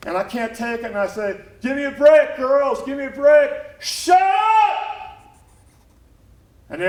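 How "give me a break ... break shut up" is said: high, loud plea building to a yell; fed-up outburst, climbing to a shout; exasperated shouting. Acted. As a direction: lose patience and scream for silence. Exasperated outburst at squabbling workers.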